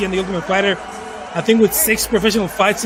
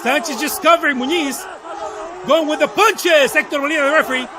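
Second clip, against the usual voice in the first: play-by-play; 5 semitones higher than usual. Real, not acted. Live play-by-play of a fight.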